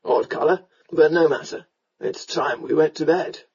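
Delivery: low tipsy remark; drunken rogue, offhand. A narrator's reading. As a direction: dismiss it casually in a drunk's voice. Drunken rescuer's voice.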